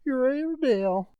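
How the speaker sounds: high, breathless servant voice; frightened butler breaking the news; alarmed servant. Acted. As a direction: blurt out the bad news. Servant reports the dog.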